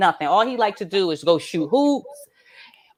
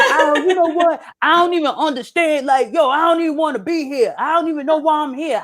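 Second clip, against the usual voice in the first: higher-pitched mock whining protest; fed-up teenage son; 6 semitones higher than usual. Son arguing with mother over dishes, fed-up protest. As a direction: imitate an exasperated kid venting. Son complains in retold argument.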